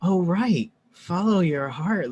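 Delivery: slow, lifted "oh, right" of discovery; dawning realization. Retold or imitated. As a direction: say it like a revelation landing. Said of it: "The way I heard it was".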